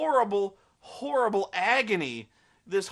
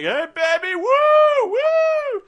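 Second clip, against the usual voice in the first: much higher, loud hooting catcall; catcalling bikers; 13 dB louder, much slower than usual. Retold or imitated. Bikers hollering at Bella, loud high catcall. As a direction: whoop it up like rowdy bikers. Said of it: "bikers who are like".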